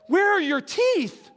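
high, slow alarmed mother's cry; a mother panicking over missing teeth; alarmed mom. Retold or imitated. Mom finds her hurt son and cries out in alarm. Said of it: "Steven's mom says".

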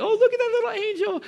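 very high-pitched mock cooing; gushing admirer. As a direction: fawn over a toddler in a squeaky put-on voice. Crowd fawning over a dressed-up toddler.

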